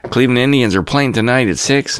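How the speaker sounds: mock the chirpy local news host, all smiles; hammed-up folksy TV anchor, bright and upbeat; folksy newscaster. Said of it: "They're folksy".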